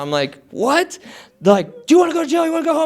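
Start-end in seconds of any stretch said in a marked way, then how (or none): 0.5-1.0 high rising disbelief; baffled. Retold or imitated. Baffled by police demand, retold. 1.9-2.8 high-pitched repeated ultimatum; insistent cop. Retold or imitated. Police repeat ultimatum, retold.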